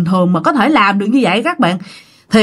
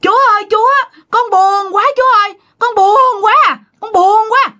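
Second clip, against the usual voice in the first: crying out to God; 12 semitones higher than usual. Retold or imitated. Possessed man calls to God at the altar, high wailing cry. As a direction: call out plaintively, pitched far up. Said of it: "la làng lên kêu vậy nè".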